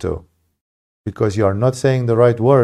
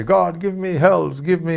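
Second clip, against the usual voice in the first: shameless demanding prayer; 6 semitones higher than usual. Retold or imitated. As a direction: mimic a greedy petitioner begging. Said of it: "you pray like a shameless imbecile".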